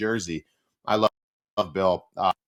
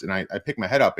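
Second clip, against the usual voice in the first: ordinary delivery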